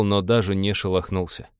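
ordinary delivery

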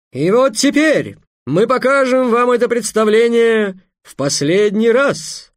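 grand, loud, high showman's announcement; booming circus ringmaster. Acted. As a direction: proclaim it like a fairground barker. Maikhel continues grand announcement. (громогласный конферансье цирка)

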